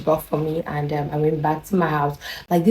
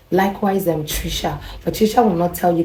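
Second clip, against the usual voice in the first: ordinary talk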